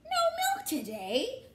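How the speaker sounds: high, slow, dismayed cry of a farmer; shocked cry. Acted. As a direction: wail it out in disbelief. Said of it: "cried the farmer".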